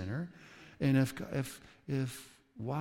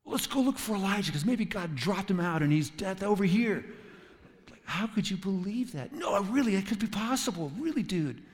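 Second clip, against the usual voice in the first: a lightly voiced naive believer, pitched up; mock the gullible friend, higher and eager; silly believer; 7 semitones higher, 3 dB louder than usual. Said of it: "silly things like".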